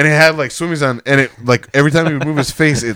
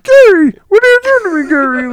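squeaky pitched-up voice-filter plea; voice changer; 5 dB louder than usual. Retold or imitated. Imitating a friend's voice-filtered video, very high squeak. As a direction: sound like a chipmunk voice filter. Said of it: "voice changes on him".

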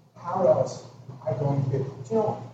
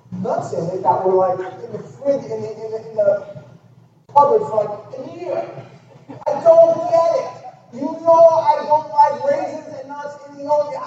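high, loud petty complaint; hammed-up husband's outburst; exasperated rant; 11 semitones higher, 10 dB louder than usual. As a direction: rant about breakfast at the top of your voice. Acted petty rant over oatmeal.

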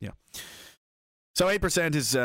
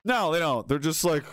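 normal talking voice

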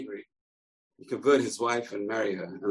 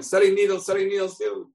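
the disguised rabbi shouting up to Akiva in jail; hawker shouting; 10 semitones higher than usual. Acted. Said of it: "he starts screaming again".

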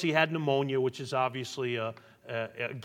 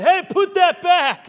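mock alarmed yelp; 15 dB louder, slower than usual. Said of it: "He said".

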